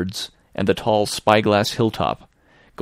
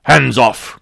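sharp loud cry, higher; pirate shouting at a sailor reaching for him; cried out; 5 semitones higher, 8 dB louder than usual. Acted. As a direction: bark it as you leap back. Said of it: "cried Silver".

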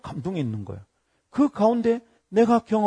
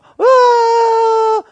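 long, very high, loud shriek; imitated fan scream at full blast; sudden mock scream; 13 dB louder than usual. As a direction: let out a piercing, sustained squeal. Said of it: "갑자기 막".

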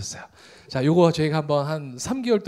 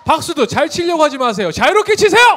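rising shout to the crowd; loud hype; 10 semitones higher, 6 dB louder than usual. Real, not acted. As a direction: pump up the audience. Host urges audience to clap.

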